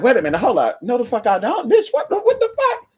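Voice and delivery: rising into a high incredulous squeak; talking herself out of wanting that man; startled self-check. Retold or imitated. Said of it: "And I'm like".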